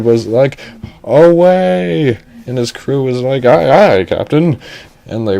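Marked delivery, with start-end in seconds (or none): 1.0-2.2 captain's loud, high call to arms; rallying cry. Acted. Captain sending the crew to the rescue, rallying cry. 3.4-4.6 loud, high acknowledgement; eager crew reply. Acted. Pirate crew answering their captain, eager shout.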